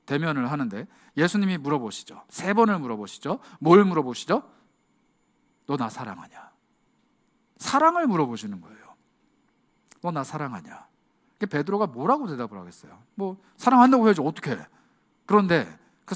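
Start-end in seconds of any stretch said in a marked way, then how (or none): 5.7-10.9 softer and lower, gentle asking; hushed loving question; tender question. Acted. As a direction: ask him quietly, like a friend. Risen Jesus asks Peter.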